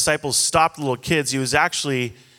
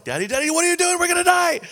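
put-on high kid's voice, panicked and yelling; faster than usual. Child in the back seat screaming at dad, high panicked yell. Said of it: "like, full-on yells".

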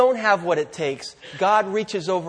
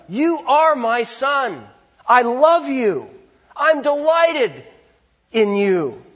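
slow, high-pitched declaration of a father's love; God the Father; 4 semitones higher, 3 dB louder, slower than usual. Retold or imitated. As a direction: declare it slowly to a beloved son, pitched up and projected. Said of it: "God the Father is saying to his Son".

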